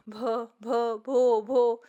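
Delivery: stuck on the first sound, pushing it out; stammering. Acted. Shy girl stammering over her own name, halting. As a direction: stammer "भ, भ, भो, भो", breaking on each syllable. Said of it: "She began to stammer".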